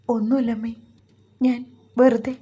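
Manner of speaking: halting, mildly upset reply; words breaking off. A narrator's reading. Crying daughter-in-law brushes off mother's question, words breaking off. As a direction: break off as if holding back tears. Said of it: "അവളുടെ വാക്കുകൾ മുറിഞ്ഞു".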